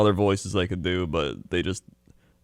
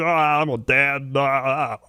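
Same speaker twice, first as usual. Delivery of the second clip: Ray Romano impression; 4 semitones higher than usual. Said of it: "That is a surprisingly accurate Ray M-, Romano".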